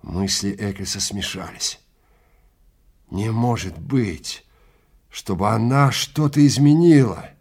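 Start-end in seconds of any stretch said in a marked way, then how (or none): none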